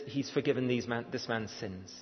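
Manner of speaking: ordinary delivery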